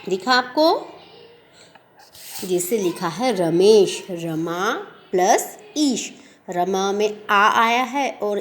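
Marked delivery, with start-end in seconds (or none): none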